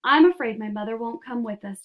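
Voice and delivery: plain reading voice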